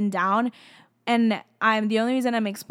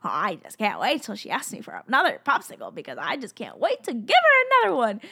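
God, bursting excitement. Acted. God eager to give another popsicle, building excitement. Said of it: "he gets so excited. He's, like, waiting with, like, excitement".

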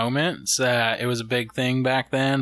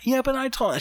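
high put-on dad voice; 12 semitones higher, faster than usual. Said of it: "quoting dad excusing".

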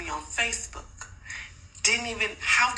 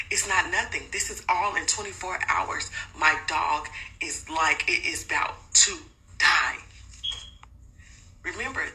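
ordinary delivery

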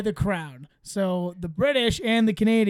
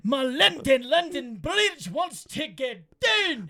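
slow, higher mock Scottish sing-song; Scottish accent; 5 semitones higher, slower than usual. Singing London Bridge for the bit, hammed-up Scottish accent. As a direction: drag the lyric out in a hammed-up Scottish brogue. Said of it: "All right, Scottish".

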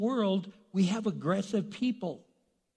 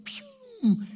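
zooming whoosh sound; 7 dB louder than usual. Retold or imitated. Joseph runs off.

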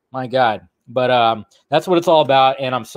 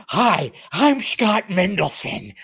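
slow, higher goofy guest voice; a light Little Nicky-ish impression of him; imitating the guest; 4 semitones higher, much slower than usual. Retelling the stream moment to viewers, mock goofy guest voice. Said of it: "did he sound a little like Little Nicky".

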